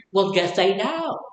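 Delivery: a hint of a prim lady's voice, slow; mocking slogan. Quotes that lady's anti-drug slogan in a lightly mocking prim voice. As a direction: lightly mimic a preachy slogan. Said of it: "like that lady said".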